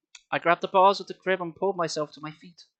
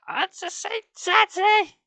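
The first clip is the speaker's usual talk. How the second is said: very high, slow cooing mother; high drawn-out coo; cooing mum; 6 dB louder, much slower than usual. Acted. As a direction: coo to the baby. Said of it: "she cooed at me".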